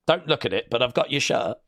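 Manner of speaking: ordinary talk, nothing marked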